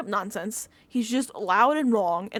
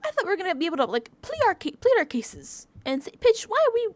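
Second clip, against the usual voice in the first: higher, whiny mock Michael; lightly mocking whine, higher; 10 semitones higher than usual. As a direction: mimic him pleading, pitched up. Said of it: "And he's like".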